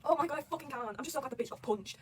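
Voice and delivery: ordinary delivery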